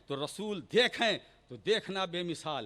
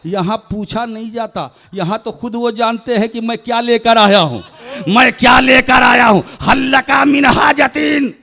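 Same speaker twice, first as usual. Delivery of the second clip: high, loud preacher's shout; impassioned sermon shout; fervent preaching; 5 semitones higher, 21 dB louder than usual. Acted. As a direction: cry it out to the congregation at full force.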